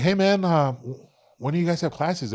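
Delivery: high, loud eager question, retold; eager asking. To the gym owner, asking loud and eager about classes. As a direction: pitch up and push it out, keen to join.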